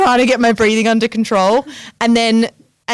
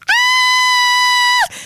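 startled shout. Retold or imitated. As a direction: yelp in sudden panic. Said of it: "I was like".

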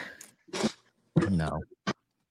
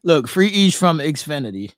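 chanted call, louder; calling from far away; 7 semitones higher, 8 dB louder than usual. Acted. Chanting, calling to lagging co-host.

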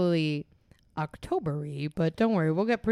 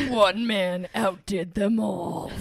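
trailer narrator; much slower than usual. Pretend. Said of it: "like a movie trailer".

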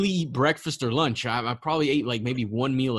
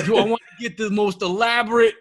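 exaggerated Tom; 6 semitones higher, 5 dB louder than usual. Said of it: "he didn't say like that, but in my, in my brain, that's how it came out".

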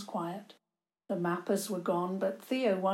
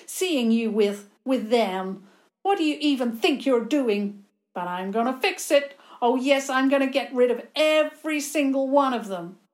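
loud, rising villain rant; angry threat; 4 semitones higher, 7 dB louder than usual. Acted. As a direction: threaten him, getting louder.

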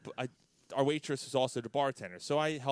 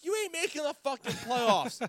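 high mock yell; retold yelling; 14 semitones higher, 5 dB louder than usual. Friend yelling at the rival fan, mocking yell. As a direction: taunt her about the playoffs. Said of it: "He's yelling at her".

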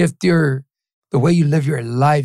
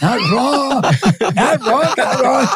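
laughing; 8 semitones higher than usual. Acted. Acted happy reply to family.

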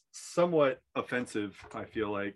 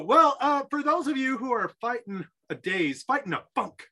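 ordinary talk, nothing marked

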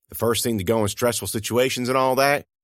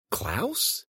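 surprised; 7 semitones higher, 8 dB quieter than usual. A narrator's reading. Surprised recognition of a figure.

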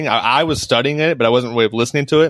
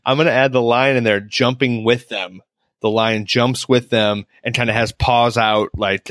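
ordinary talk

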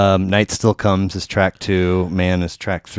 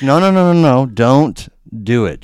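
much higher and louder no no no; urgent pleading; 6 semitones higher, 7 dB louder than usual. Retold or imitated. Listener shouting at the comedian's story, urgent pleading. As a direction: stop him before he texts. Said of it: "but I was like".

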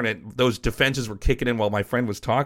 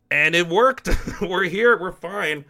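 triumphant; 6 semitones higher, slower than usual. Real, not acted. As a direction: let the win burst out with a laugh. Triumphant payoff of story.

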